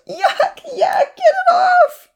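very high, loud disgusted shriek; wailing. A narrator's reading. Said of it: "Lily wailed".

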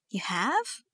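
questioning, sharply rising disbelief; astonished. A narrator's reading. Verity hearing Thomas has forgiven Dad, astonished. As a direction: echo it back in surprise. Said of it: "she repeated in astonishment".

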